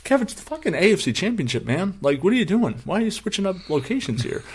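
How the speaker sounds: high, disbelieving rant at Kevin; exasperated retelling. Retold or imitated. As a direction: retell your outrage at your friend. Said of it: "I'm like".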